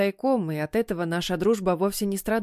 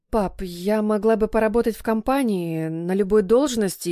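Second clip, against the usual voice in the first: ordinary delivery, nothing marked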